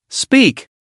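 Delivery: high sharp scream of a command. A narrator's reading. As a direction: shriek it at him. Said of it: "Sun Na screamed".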